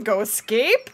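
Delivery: ordinary delivery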